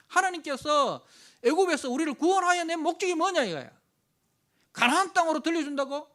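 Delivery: loud, high, scornful accusation against God; the people railing at God in the desert; bitter accusation. Retold or imitated. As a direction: shout the grievance with contempt. Voicing Israelites' bitter accusation.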